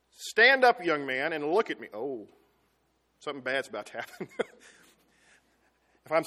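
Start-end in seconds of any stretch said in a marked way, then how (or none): 0.1-1.9 stern dad. Retold or imitated. Father ordering his slouching son to stand, stern and loud. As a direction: call him to attention like an angry dad. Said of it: "Dad ever tell you that?".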